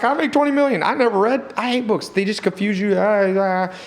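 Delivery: high-pitched, dismissive mimicry; scoffing, high put-on voice trailing into babble; mocking neighbor impression. Mimics neighbor scorning books.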